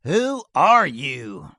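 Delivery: loud, slow booming challenge; big booming voice. A narrator's reading. Outlaw challenging the sheriff, big booming voice. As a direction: let the voice swallow the valley. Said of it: "The big voice fairly swallowed".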